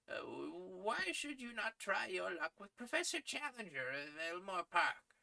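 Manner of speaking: put-on high, thin voice of a kindly old editor. Editor praising young Malone's articles, high old-man voice. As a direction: pitch him up as a fussy old newsman.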